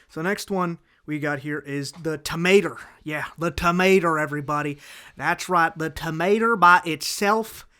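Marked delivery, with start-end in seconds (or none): 1.9-5.8 put-on loud, hammy country-style accent; mock rural accent, punched loud; put-on drawling accent. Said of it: "doing the accent". 5.8-7.7 put-on TOMATO said "tuh-MAY-ter" in a loud country-style accent; hammy accent, "by" flattened to "bah" in "by itself"; put-on drawling accent. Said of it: "doing the accent".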